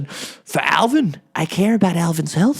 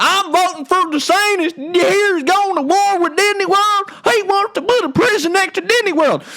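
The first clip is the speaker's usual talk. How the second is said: mocking voter. Mocking DeSantis voters, in a high silly voice. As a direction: parrot the DeSantis fan in a silly squeak. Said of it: "They're like".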